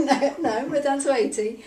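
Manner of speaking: high, loud burst of laughter; laughing. Real, not acted. Client bursts out laughing at joke.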